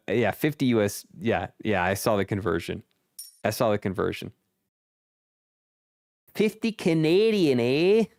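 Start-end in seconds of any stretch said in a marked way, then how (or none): 6.3-8.1 a hammed-up Canadian accent, much higher, with a tag eh; mock Canadian accent. Teasing the Canadian guest about the book price, mock Canadian accent.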